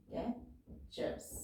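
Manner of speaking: Captures breathed out very softly and slowly; almost whisper captures, slow; soft slow dictation. Shown as a demonstration. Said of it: "word है captives". (Captures को बहुत धीरे और फुसफुसाकर बोलना)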